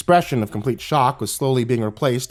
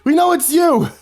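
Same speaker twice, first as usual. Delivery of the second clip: put-on high, loud boyish yell; shout; 6 dB louder than usual. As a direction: scream it at the principal. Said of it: "Scotty shouted".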